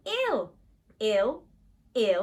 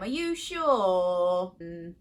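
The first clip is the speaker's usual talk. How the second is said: usual speaking voice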